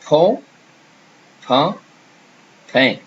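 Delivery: "pont" nasal o, "pain" nasal a, "pain" nasal e; French vowels pont, pain, pain. Shown as a demonstration. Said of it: "So if I say, for example".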